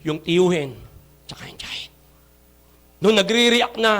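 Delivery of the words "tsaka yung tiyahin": whisper. Real, not acted. Aside, whispered.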